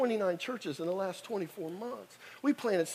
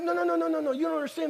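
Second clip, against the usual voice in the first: pleading; 10 semitones higher, much faster than usual. Retold or imitated. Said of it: "And I'm like".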